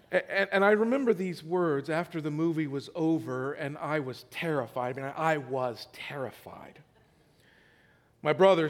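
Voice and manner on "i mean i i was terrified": breathy whispered confession, voice dropped away. Real, not acted. Pastor recalling his childhood terror, in a whisper. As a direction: whisper it as if still scared.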